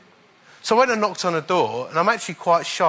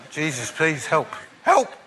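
panicked prayer; slower than usual. Retold or imitated. Preacher retelling his panicked prayer for the lad, laughing cry. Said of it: "I've only got one answer".

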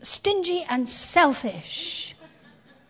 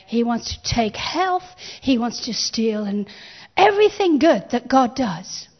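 ordinary delivery

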